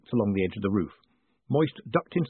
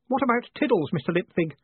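put-on high, anxious boyish voice; a boy suddenly fretting over his cat; worried boy Stanley; 8 semitones higher than usual. A narrator's reading. Stanley asks Moist about the cat in a worried boyish voice.

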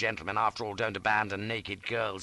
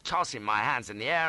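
ordinary delivery

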